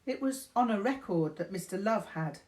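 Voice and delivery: ordinary talk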